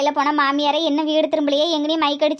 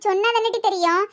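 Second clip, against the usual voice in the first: usual speaking voice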